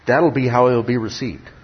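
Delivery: normal talking voice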